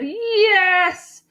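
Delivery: high, drawn-out yes; high-pitched, insistent retort; stubborn insistence. A narrator's reading. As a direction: argue back emphatically. Cashier shouts back in quarrel.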